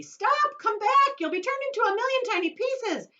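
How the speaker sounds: very high, loud panic; mother terrified for her son; frantic screaming. Acted. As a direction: plead at a scream. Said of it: "screamed Missus T V".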